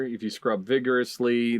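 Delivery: normal talking voice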